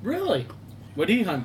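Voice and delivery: ordinary delivery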